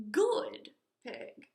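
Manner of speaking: usual speaking voice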